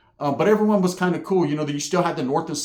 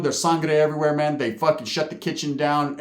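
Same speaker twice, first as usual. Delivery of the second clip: normal talking voice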